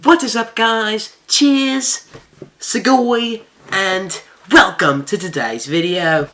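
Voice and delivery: high, hyped YouTuber intro; bright hype-up greeting to viewers; breathless gossipy storytime. Acted.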